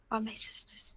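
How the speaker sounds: softly corrects "they are my sisters", barely voiced; quiet correction. Correcting herself about her sisters, near whisper. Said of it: "they are my sisters".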